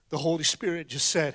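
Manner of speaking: ordinary talk, nothing marked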